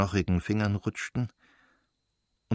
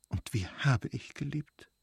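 anguished lament; 6 semitones higher, 7 dB quieter than usual. Acted. Wife laments her lost love.

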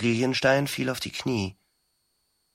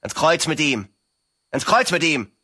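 shouting; 4 semitones higher, 4 dB louder than usual. A narrator's reading.

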